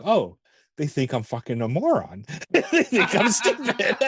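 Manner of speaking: a hint of an indignant little alien, giggling; alien Rocky voice. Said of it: "he's like".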